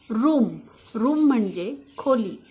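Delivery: ordinary delivery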